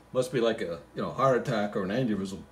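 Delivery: ordinary delivery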